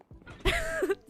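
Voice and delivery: a quick high burst of laughter; short high-pitched giggle. Real, not acted. Streamer laughs at own remark.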